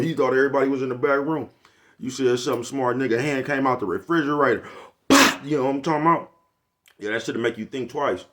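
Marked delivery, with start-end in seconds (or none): 5.1-5.4 loud high sharp pop; mock backhand pop; slap sound. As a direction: smack the word out like a slap.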